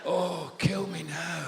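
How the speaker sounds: slow, drawn-out exasperated groan of words; weary despair. Acted. Householder sick of the advert, weary despair. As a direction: drag it out in mock despair.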